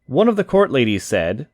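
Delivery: plain reading voice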